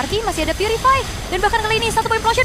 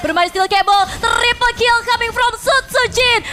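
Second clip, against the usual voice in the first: high, loud announcer hype; fighting game announcer; 10 semitones higher, 6 dB louder than usual. Real, not acted. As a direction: shout the winning push. Caster calls the game-winning fight.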